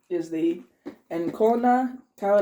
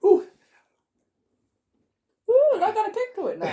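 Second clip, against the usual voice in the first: high, squealed reaction to the heat; surprised ooh; 5 dB louder than usual. Real, not acted. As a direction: yelp as the chili kicks in. Chili heat kicks in.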